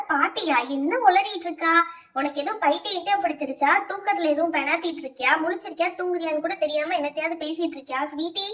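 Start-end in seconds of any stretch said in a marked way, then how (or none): none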